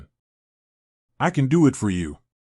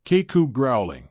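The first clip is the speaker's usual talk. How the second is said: guttural growl of a man losing control. Acted. A possessed man growls while his comrade pleads with him. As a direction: let the demon growl out of the throat.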